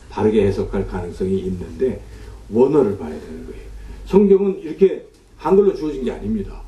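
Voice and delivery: normal talking voice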